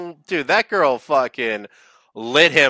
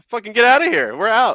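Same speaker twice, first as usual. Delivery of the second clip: acted dismissal; 8 semitones higher, 3 dB louder than usual. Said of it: "And then she's like".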